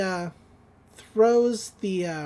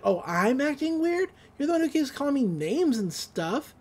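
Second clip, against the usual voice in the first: higher-pitched mock indignation; indignant retort; 7 semitones higher than usual. Brother answering sister in a quarrel, indignant retort. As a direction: throw the accusation back, higher.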